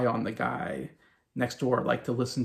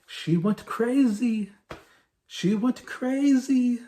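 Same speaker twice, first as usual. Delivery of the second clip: much higher, slow, put-on waiter voice; Manuel's voice; 12 semitones higher, 3 dB louder, much slower than usual. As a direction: drag out each word in a high comic waiter voice. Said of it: "the way Manuel says that".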